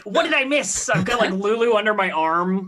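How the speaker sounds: breathless high-pitched return; excited high yelps. Acted. Player bursts back into the scene, high and excited. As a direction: yelp high, rushing back in.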